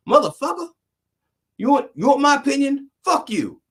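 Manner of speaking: high, brash mock cussing; cussing comedian. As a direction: blurt it out like a foul-mouthed comic. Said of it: "him on T V saying".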